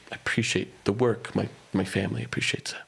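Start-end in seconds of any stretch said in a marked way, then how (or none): none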